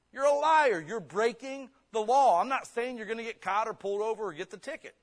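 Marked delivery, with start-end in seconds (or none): 0.1-2.4 loud and high, slowing on the law; loud rebuke. Acted. Preacher rebukes the speeder with a loud, slow rebuke. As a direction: call the speeder a liar, hard and loud.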